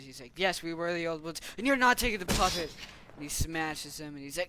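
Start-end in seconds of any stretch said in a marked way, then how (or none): 0.3-2.7 fast, loud, rising mock anger; play-acted defiance. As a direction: refuse hotly, in a make-believe quarrel. Figures quarrel over the puppet.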